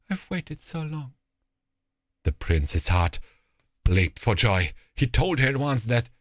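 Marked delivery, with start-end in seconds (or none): 0.1-1.1 soft, high princess murmur; whispered. A narrator's reading. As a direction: keep it hushed, light and high. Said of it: "she whispered".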